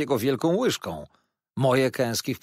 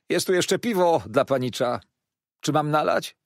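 higher, louder waiter's offer; raised, forward waiter voice; jovial waiter; 6 semitones higher, 5 dB louder than usual. A narrator's reading. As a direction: offer a drink in a hearty, raised servant's voice. Waiter offers the boy beer.

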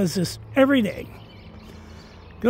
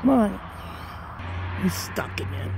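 ordinary delivery

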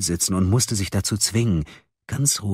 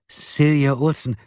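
slow, higher, hesitant name; stammering; 7 semitones higher, 3 dB louder, slower than usual. A narrator's reading. Frightened girl tells the caller her name, stammering. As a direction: give the name haltingly. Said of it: "stotterte Silje".

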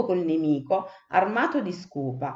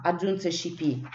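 usual speaking voice

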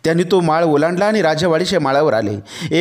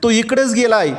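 higher-pitched, urgent calling out; sudden cry; 7 semitones higher than usual. A narrator's reading. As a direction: call out as if suddenly spotting a sign. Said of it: "ती एकदम ओरडली".

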